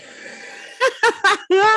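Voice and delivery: high, explosive burst of laughter; loud laughter. Real, not acted. Friend caught out by co-host's dealer joke, laughing hard. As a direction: crack up loudly.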